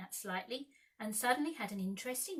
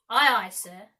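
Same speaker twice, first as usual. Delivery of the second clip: pirate; 16 dB louder than usual. A narrator's reading. Pirate answering Hook.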